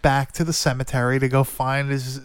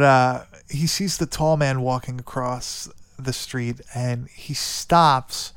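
ordinary talk

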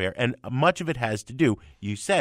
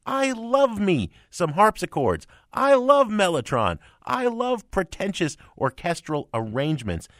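gushing; 7 semitones higher than usual. Real, not acted. Gushing about prog rock.

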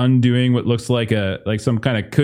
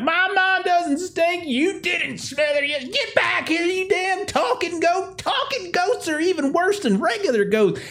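angry yokel yell. Acted. Farmer yells after the fleeing talking goat. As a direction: chase him down hollering.

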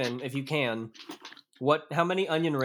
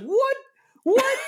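very high, loud squeaked what; incredulous yelp, played up; mock bewilderment; 6 dB louder than usual. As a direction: imitate shocked confusion, way up high. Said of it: "I was like".

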